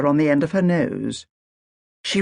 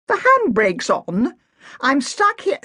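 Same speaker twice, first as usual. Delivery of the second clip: indignant protest; 10 semitones higher than usual. Acted. Agatha protests to policeman.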